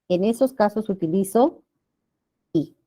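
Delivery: ordinary talk, nothing marked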